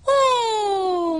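high, drawn-out gasp of wonder; awed oh. A narrator's reading. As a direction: gasp like seeing something magical. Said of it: "mondták mindannyian".

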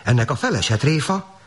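high, dismayed questioning; acted panic, pitched high; alarmed exclamation. A narrator's reading. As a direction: wail in bewilderment at normal volume. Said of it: "kiáltott fel".

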